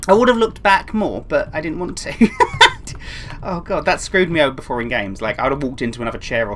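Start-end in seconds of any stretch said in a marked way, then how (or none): 2.3-3.4 high-pitched burst of laughter; nervous laugh. Real, not acted. Gamer admitting they didn't look back, high laughing.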